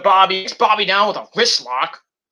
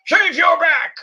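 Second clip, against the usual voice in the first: mock quote; 11 semitones higher, slower than usual. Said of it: "Remember that?".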